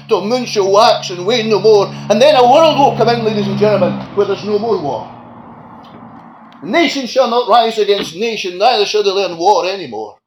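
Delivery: loud, rising preacher's cry; booming pulpit climax; preaching at full force. Acted. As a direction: lift the congregation with a ringing proclamation.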